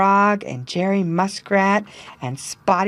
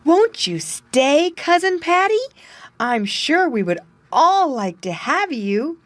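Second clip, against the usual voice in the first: pleading muskrat voice; 10 semitones higher than usual. A narrator's reading. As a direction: ask warmly, hoping he stays. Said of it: "Jerry pleads with Paddy".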